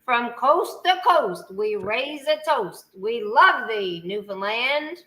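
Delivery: slow ceremonial recital, line by line; host leading the Screecher's Creed for guests, slow and solemn. Shown as a demonstration. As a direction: lead the toast like an oath. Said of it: "I'm gonna say it, and you're gonna repeat after me".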